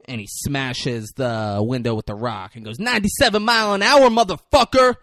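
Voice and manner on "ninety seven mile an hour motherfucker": loud, fast, high-pitched taunt; hammed-up film shout; retold triumphant yell. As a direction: yell it like a boast mid-throw. Acting out film window smashing.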